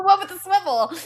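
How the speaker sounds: high, loud disbelief; can't believe it was that easy; incredulous. Real, not acted. Contestant to host, amazed the answer was obvious, high and loud.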